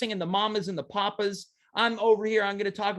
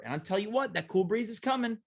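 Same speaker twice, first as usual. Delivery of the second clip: ordinary talk, nothing marked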